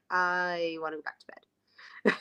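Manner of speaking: slow, soft, mock-sleepy groan; put-on reluctant riser; wanting to snooze. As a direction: drag it out like someone refusing to get up. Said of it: "wanna hit snooze and be like".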